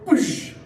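a quick high "boosh" splash noise; mock splash sound. Guy throws Coke at waiter, splash noise.

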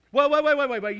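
loud, high hammed-up protest; mock protest. Preacher acting an older saint protesting to students, loud mock panic. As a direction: shout him down in mock panic. Acted elder protesting loudly.

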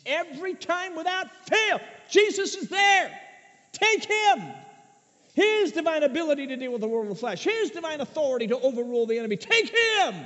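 preaching shout. Real, not acted. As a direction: cry it out to the congregation. Sermon climax, shouting appeal.

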